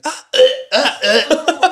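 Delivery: slow, high, loud mock gagging; imitated retching. Patient gagging on blood and pus in the chair, retching. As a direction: act out dry heaving.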